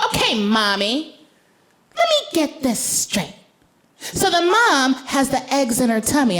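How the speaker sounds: put-on high, careful child's voice; lightly voiced high little girl; 1 serious little girl. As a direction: spell it out like a thoughtful toddler. Daughter voiced reasoning.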